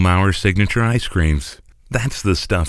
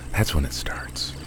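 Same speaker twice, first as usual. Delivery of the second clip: hushed dramatic whisper; 7 dB quieter than usual. Acted. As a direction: breathe it like a secret. Dramatic ad voiceover drops low.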